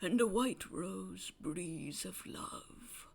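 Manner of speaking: slow, soft recital; poetic recital. Acted. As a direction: recite tenderly, savoring each line. Old man reciting poem.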